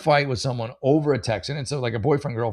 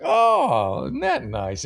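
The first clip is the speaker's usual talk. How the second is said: imitating Peele; much slower than usual. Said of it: "and then Peele is like".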